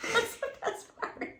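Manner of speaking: breathy high giggle; soft laughing. Real, not acted. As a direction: laugh it out quietly. Laughing mid story.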